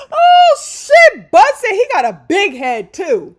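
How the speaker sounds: loud, high, real yell of delight; excited shout. Real, not acted. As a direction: shout it out, thrilled. Reacting to Bud's big-head jab.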